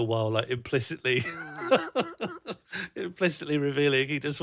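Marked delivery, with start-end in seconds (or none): 1.3-2.9 squeaky laugh bursting out; laughing at a friend's hidden softness; high squeal of laughter. Real, not acted. Laughs mid-sentence.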